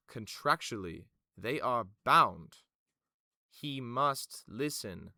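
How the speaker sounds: slow, loud, formal insistence; firm official. Acted. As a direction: lay down the rule word by word. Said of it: "She goes".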